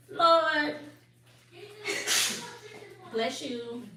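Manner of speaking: ordinary talk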